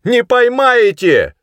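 high, loud, drawn-out taunt; ringing shout. A narrator's reading. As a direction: call back defiantly from afar. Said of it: "звонко отозвался Плевака". (звонкий протяжный выкрик)

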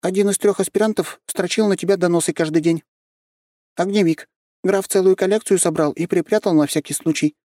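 lightly voiced young girl; high girl voice. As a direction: speak up high like a small girl. Narrator voices girl Nyusha.

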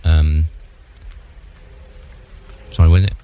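low, loud voice over road noise; talking over traffic. Acted. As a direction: raise the voice above passing traffic. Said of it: "I'm walking up the A one at the moment".

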